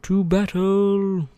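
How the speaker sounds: high, loud drawn-out war cry; playful commander's charge, stretched out and belted; battle cry. Pretend. Mock battle cry in game.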